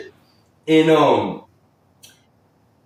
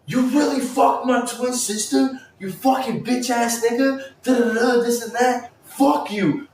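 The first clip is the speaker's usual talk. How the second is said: put-on high, yelled outrage in a girl's voice; higher shouting girl cussing him out; furious sister; 9 semitones higher than usual. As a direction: tear into him like the betrayed twin. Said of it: "she's like".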